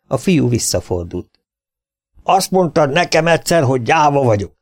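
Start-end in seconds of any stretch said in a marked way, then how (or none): 2.2-4.6 high, defiant call, raised voice; sharp challenging shout. A narrator's reading. Johnson calls after the leaving boy, high defiant shout. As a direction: throw the accusation back at him. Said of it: "kiabálta éles, kihívó hangon".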